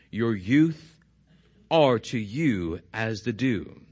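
measured, deliberate reading of the psalm; scripture reading. Acted. Pastor reading the psalm aloud to the congregation, measured.

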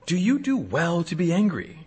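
soft, level, probing question; God's quiet question. Retold or imitated. God asking Jonah, soft and searching. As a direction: gently ask him to look at his anger.

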